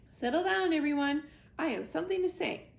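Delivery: high, loud hooting call to the crowd; hooting owl. Acted. Swooper the owl calling the crowd to order, hooting loud. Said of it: "hooted to the crowd".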